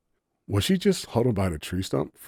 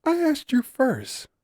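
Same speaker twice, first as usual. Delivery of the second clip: higher, slow, teasing challenge; challenging; 3 dB louder, slower than usual. A narrator's reading. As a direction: dare her to answer first. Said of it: "she challenged".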